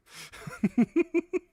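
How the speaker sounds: loud, high-pitched laugh; sudden burst of high laughter; teasing laugh. Real, not acted. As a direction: cackle loudly up high. Laughs at own age teasing.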